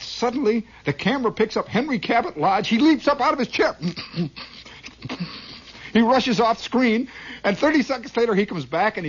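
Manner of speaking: high, loud building excitement; excited climax. Real, not acted. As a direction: tell the climax breathlessly. Climax of comic story.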